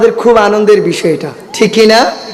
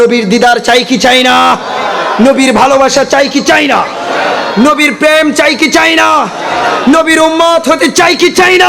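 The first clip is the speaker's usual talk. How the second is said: climbing higher with each question; rousing call; 6 semitones higher than usual. Real, not acted. Preacher rousing the crowd. (ক্রমশ উঁচু স্বরে জাগিয়ে তোলা প্রশ্ন)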